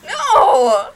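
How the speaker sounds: sharp loud no; loud protest. Real, not acted. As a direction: shout it in protest. Protests killing off Worm.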